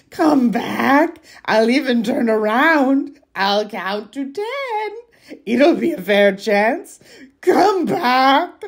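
high, loud, slow pleading creature; troll voice. Acted. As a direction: beg them back like a cunning troll. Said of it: "Your troll voice is on point".

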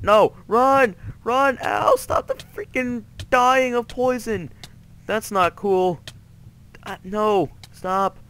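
high, frantic cries of protest; panicked yelling. Real, not acted. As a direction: shout in alarm while fleeing. Fleeing and hurt by poison.

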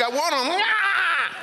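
put-on high squealing baby cry; mock baby scream. Imitating people screaming like a baby at the altar, high squeal. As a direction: shriek like a wailing infant.

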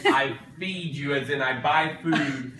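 slow, deliberate explaining; slow lecture. Real, not acted. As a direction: spell it out slowly. Lecturing child slowly.

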